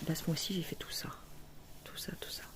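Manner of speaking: whispered to herself. Real, not acted. Showing this month's stitching to viewers, whispered. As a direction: murmur it almost without voice.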